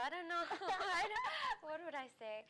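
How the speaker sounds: high, loud, laughing fluster. Real, not acted. Pageant contestant asked for her winning speech, flustered laughing. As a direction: burst out laughing while stuck for an answer. Put on the spot about speech.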